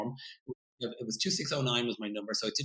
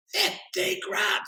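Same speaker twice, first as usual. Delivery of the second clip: drawn out slow, much higher in wonder; amazed; 5 dB louder, much slower than usual. Retold or imitated. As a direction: stretch it out, dazzled by the sum. Amazed at the pilot budget.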